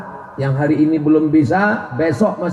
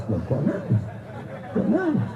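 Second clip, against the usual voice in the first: mock puzzled murmur; 9 dB quieter than usual. Hard-hearted man mocks weepers.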